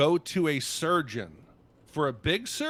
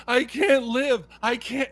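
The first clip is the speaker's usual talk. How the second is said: slow, louder, higher desperate plea; veteran breaking down; 8 semitones higher, 7 dB louder than usual. Retold or imitated. Retelling a veteran's breakdown on a traffic stop, desperate pleading. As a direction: voice a man at the end of his rope, pleading. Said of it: "And the guy's like".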